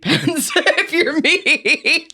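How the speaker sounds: laughing through the words, high and drawn out; high, stretched-out giggly confession. Real, not acted. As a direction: break up laughing at yourself. Joking about own lost pens.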